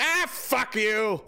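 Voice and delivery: slow, high sneering brush-off; mock dismissive kid. Grandkids brushing off grandparents, slow high sneer. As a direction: drag it out like a rude teen. Ungrateful kids voiced.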